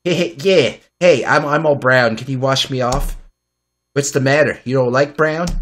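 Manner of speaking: usual speaking voice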